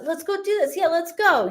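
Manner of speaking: rally the group to go; hyped friend. Retold or imitated. Medium imitating the hyper friend rallying everyone, eager. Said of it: "her wanting to".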